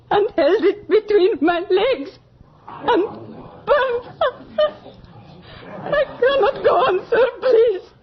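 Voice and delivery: crying as the memory overwhelms her; breaking into tears. Acted. Seamstress sobs as she tells the magistrate of torture. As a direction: voice rising and cracking into sobs.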